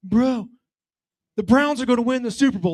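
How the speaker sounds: slow, high, spaced-out stoner voice; stoned teen rambling in the hot tub. Retold or imitated. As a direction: drift through it like you're baked. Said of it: "clearly they're messed up".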